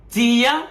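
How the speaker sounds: চিয়া said high with a rising tune; teacher drilling চিয়া with a rise; rising chia. Shown as a demonstration. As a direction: lift the pitch on চিয়া, chee-a. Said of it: "চা".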